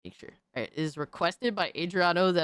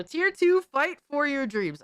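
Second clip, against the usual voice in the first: excited announcement; 7 semitones higher than usual. Real, not acted. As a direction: pitch jumps up, hyped to start. Announcing the next tier.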